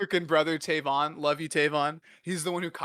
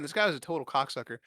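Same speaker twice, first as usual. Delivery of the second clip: ordinary talk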